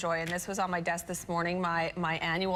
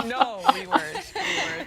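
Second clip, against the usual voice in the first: laughing; 10 semitones higher, 7 dB louder than usual. Real, not acted. Laughing denial.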